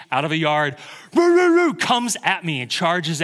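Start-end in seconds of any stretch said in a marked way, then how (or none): 1.1-1.7 high loud imitated barking; dog barking. Pastor imitating the dog charging him. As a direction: bark like a charging German Shepherd.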